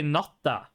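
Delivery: "natta" with a long doubled t and an open final a; "natta" uzun çift t'yle, sonu açık a; dialect natta. Shown as a demonstration. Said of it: "in my dialect, I would say".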